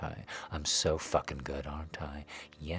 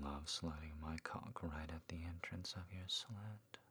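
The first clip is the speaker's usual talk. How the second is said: whispering; 11 dB quieter than usual. Acted. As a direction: hush it gently. Whispered aftercare.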